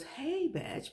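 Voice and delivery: high, sassy "Betch" like the Kelly character; Kelly's Betch. Retold or imitated. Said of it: "His Kelly character".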